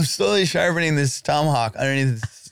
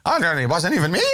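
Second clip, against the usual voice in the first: lightly voiced protesting prisoner; mock innocent convict; 4 semitones higher than usual. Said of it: "like that guy".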